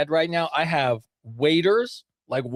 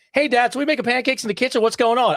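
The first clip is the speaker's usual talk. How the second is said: higher-pitched imitation of an eager kid; raised kid voice, louder at the end; chatty small child; 8 semitones higher, 3 dB louder than usual. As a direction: quote a little boy suddenly full of words. Voices son suddenly talking fluently.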